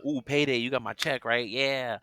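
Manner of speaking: imitating a delighted coworker; happy employee. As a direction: greet the payroll person like it's a treat. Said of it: "people were happy to see you come".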